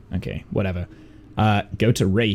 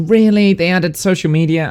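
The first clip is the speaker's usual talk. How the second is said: surprised; 6 semitones higher, 4 dB louder than usual. Real, not acted. Surprised at game feature.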